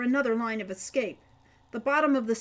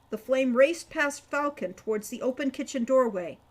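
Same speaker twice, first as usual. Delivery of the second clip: ordinary delivery, nothing marked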